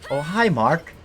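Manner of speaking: a light nod to the famous Oh hi Mark line; movie quote impression. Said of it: "That's actually a misquote".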